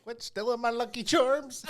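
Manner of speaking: high, loud mock-Irish cartoon voice; hammed-up Irish mascot squeal, badly faked; Irish accent. Host attempting an Irish accent for co-host, high and hammed-up. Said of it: "I'm just trying to do an Irish accent".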